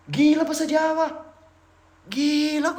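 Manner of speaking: loud, high-pitched disbelief; retold astonishment. Traveler hearing a foreigner speak Javanese, astonished. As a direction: blurt it out, stunned. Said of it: "aku waktu itu intinya speechless banget".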